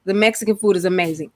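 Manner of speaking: ordinary delivery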